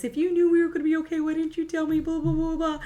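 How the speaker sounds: fast, high mock yell at Thomas; hammed-up angry Kat, high and rushed. Recapping Kat yelling at Thomas, fast high mock yell. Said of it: "yells at Thomas".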